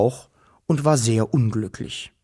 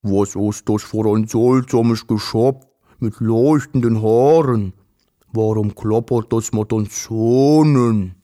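booming creature with rounded, darkened vowels; deep voice, long drawn-out O-like vowels; deep strange voice; 3 semitones lower, 4 dB louder than usual. A narrator's reading. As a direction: speak a foreign creature tongue, swelling louder. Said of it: "Eine tiefe Stimme sagte".

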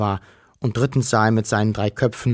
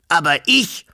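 high, fussy dragon interrupting; mild mock hiss of the dragon; 6 semitones higher, 3 dB louder than usual. A narrator's reading. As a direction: butt in as the nervous dragon. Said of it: "fauchte der Drache".

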